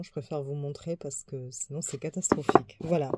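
normal talking voice

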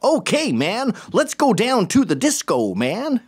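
hammed-up American accent, high and drawn out; mock British attempt at an American accent; slow, high-pitched caricature American accent; bad American accent. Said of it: "Do an American accent".

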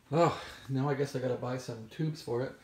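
ordinary delivery

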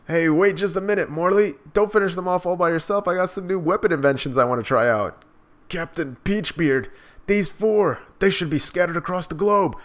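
a soldier itching to try new weapons; eager commander. Acted.

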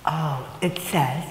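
usual speaking voice

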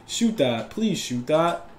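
ordinary talk

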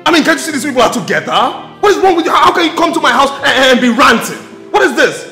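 high, loud, fast rant; indignant, spluttering outburst; angry shouting. Acted. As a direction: rant at the intruder at high pitch. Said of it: "You can now shout".

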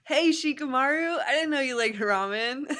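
high, loud, chipper greeting; cheery Naruto. Acted. Naruto greets Shikamaru, loud cheerful high voice. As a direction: bounce in like a hyper kid.

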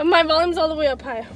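loud. Real, not acted. Headphones loud, can't hear self.

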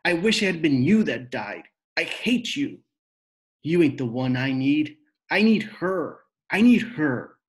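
high, loud, raw screaming. A narrator's reading. Rob screaming his grief and hate at his father. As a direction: let everything explode out at once. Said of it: "he screamed".

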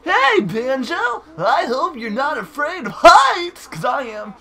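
excitable game bird. Acted. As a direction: squawk it out as an excitable cartoon bird. Reads game bird's dialogue in character.

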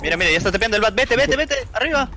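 high, loud urgent shouting; combat callout. Real, not acted. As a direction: yell orders mid-fight. Urgent callout during team fight.

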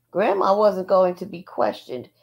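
usual speaking voice

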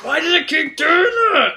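high, loud complaint; real frustrated outburst; exasperated yell. Real, not acted. Controls misfire in game.

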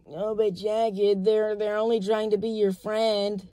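high, drawn-out imitation of an excuser; mock pitying bystander. Others excusing the fake friends, mock pitying. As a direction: plead their case, mockingly slow.

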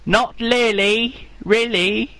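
high, slow fake Chinese accent; a slow put-on Chinese accent. Pretend farmer corrects the host, fake Chinese accent. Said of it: "I can't do the accent".

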